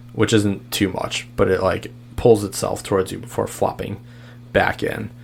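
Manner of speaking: usual speaking voice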